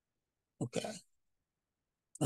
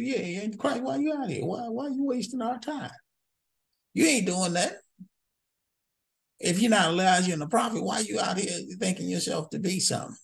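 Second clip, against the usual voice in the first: higher, scoffing voice of doubters; mocking challenge; 7 semitones higher than usual. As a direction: scoff at him like a skeptical crowd. Mocking the crowd's challenge to John.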